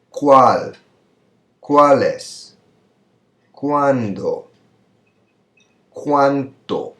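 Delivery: isolated question words with long pauses for repetition; repeat-after-me modelling. Shown as a demonstration. Teacher drilling question words for students to repeat, high and loud. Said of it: "So, repita, por favor".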